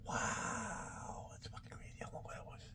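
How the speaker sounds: hushed, breathy whisper to himself; whispered aside. Real, not acted. Reading an old date on a drumstick, he whispers to himself in awe. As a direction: whisper it, amazed at how long ago.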